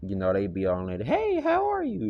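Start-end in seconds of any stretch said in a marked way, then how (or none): 1.1-2.0 very high, airy mock girl greeting; high mock valley-girl hello; mocking girl's voice. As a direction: squeak it out like the ad girl. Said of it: "She for sure sounded like an Edomite".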